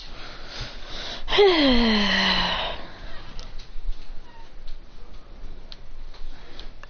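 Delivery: soft, drawn-out airy exhale; long sleepy sigh. Acted. Character falls asleep after the gummies, long soft exhale. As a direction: breathe out slowly as if asleep.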